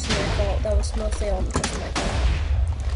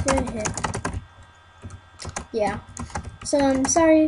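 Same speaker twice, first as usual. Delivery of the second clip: usual speaking voice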